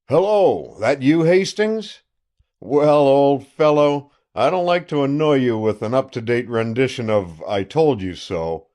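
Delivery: loud, boastful country sheriff on the phone; a gloating sheriff calling an old friend; triumphant sheriff. Acted. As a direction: crow over the news like a self-satisfied lawman. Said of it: "Mister Crown, triumphant".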